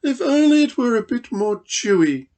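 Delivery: a grand lady's fussy sigh; sighing emu. Acted. Emu sighs over the stew to Dingo, high and fussy. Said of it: "she sighed".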